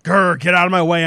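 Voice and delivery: loud growl, like an angry dog. Pretend. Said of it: "being like".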